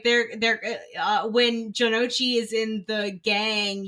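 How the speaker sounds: usual speaking voice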